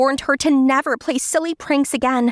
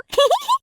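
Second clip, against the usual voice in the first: put-on high girlish giggle. Summer giggles at prank.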